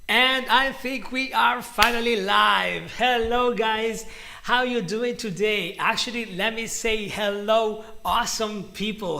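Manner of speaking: loud, lifted hello to the chat; eager livestream welcome; excited greeting. Real, not acted. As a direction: welcome everyone in with a burst of energy. Streamer opening the live class.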